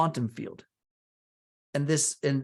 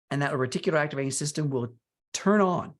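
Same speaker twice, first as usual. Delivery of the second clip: usual speaking voice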